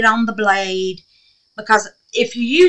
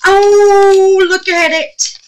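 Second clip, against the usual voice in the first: high, loud thrilled cries; gasping with delight at the result; real excitement; 9 semitones higher, 6 dB louder, slower than usual. Real, not acted. Crafter sees the powder worked, thrilled and loud. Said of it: "I get excited when something works".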